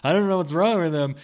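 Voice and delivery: high, loud, blaming exclamation; loud accusing dad. Retold or imitated. Dad tells the family about the couch, loud and accusing. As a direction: point at the son and loudly disown him. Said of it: "he said".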